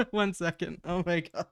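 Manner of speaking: happy crying. Real, not acted. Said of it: "happy tears".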